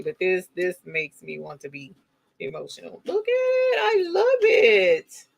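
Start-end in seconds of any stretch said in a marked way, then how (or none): 3.0-5.2 very high squeaky voice, drawn out; squeaky gushing. Real, not acted. Gushing over her finished scarf.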